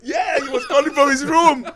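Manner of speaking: loud, high words through laughter; real laughing exclamation. Real, not acted. Guest confirming the absurd punchline to the host, laughing hard. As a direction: crack up while insisting it's true. Cracking up at own story.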